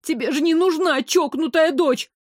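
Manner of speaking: high, alarmed protest; frightened. A narrator's reading. Girl in the tree argues with her mother, frightened. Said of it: "Ещё сильнее испугалась я".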